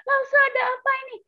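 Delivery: high, panicky cries calling friends by name; frightened call for help. Pretend. Scared Lasa calls her friends to help her. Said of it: "minta tolong".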